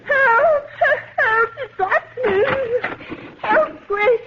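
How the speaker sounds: shrill, crying calls for help in the dark; terrified cries for help. Acted. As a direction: scream like something has grabbed you. Trapped in dark closet, panicking.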